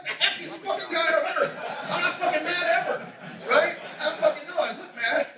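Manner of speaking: high, half-laughing insistence; laughing protest. Retold or imitated. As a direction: protest through your own laughter. Laughing protest he's not mad.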